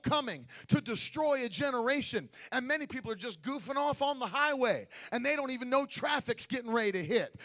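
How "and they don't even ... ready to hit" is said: ordinary delivery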